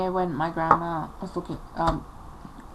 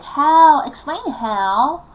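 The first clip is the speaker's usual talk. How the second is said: loud, drawn-out demand for an answer; indignant challenge; 6 semitones higher, 10 dB louder, much slower than usual. Retold or imitated. Daughter challenges mother's scratch excuse, indignant. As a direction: punch each "how" loudly and stretch it.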